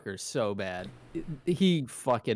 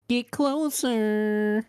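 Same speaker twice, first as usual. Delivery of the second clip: much higher, very slow put-on voice; movie quote; 11 semitones higher, 3 dB louder, much slower than usual. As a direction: drawl it out like the film. Quoting Rush Hour line.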